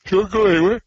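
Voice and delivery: said through a big yawn, pitch jumping high. Real, not acted. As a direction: let a real yawn stretch the words. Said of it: "didn't mean to yawn on you".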